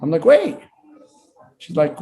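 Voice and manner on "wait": high, sharp call to stop her hanging up; urgent wait. Retold or imitated. Al stopping Maria on the phone from hanging up, urgent high call. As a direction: catch her before she hangs up.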